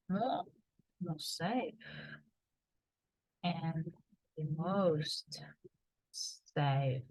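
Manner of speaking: softly spoken, with halting pauses; quiet quoting. Shown as a demonstration. Demonstrating what people say instead.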